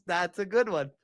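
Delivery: ordinary delivery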